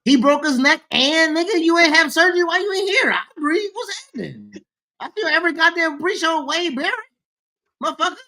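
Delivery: loud, fast, high-pitched ranting; heated rant. Real, not acted. Heated rant at absent friend.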